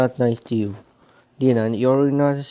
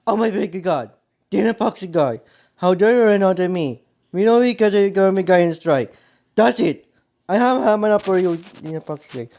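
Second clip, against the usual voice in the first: higher and louder, yelling in real anger; real furious outburst; 7 semitones higher, 4 dB louder than usual. Real, not acted. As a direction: let the rage boil over at him. Furious rant at the YouTuber.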